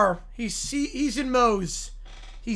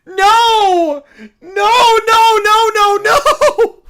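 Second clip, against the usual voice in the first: laughing wail; 10 semitones higher, 13 dB louder, much slower than usual. Acted. Wailing denial in game.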